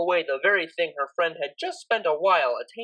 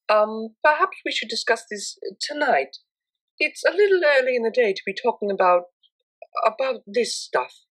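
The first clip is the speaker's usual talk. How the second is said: higher, lightly voiced prim mare, awkward; prim, uncomfortable mare; 5 semitones higher than usual. A narrator's reading. Octavia putting off the talk with Vinyl, higher and awkward. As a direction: politely put the topic off.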